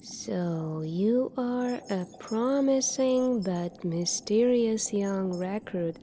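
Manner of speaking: usual speaking voice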